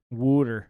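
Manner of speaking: "Water" as WOOD-er, loud first syllable; Philly pronunciation. Retold or imitated. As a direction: punch out "Water" as a Philadelphian WOOD-er. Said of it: "water instead of water".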